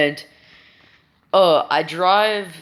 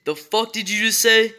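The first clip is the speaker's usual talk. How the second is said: higher, angry mock yell at her son; retold angry yell, pitched up; mom yelling; 6 semitones higher than usual. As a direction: replay mom snapping back in shock. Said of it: "she just yells at me".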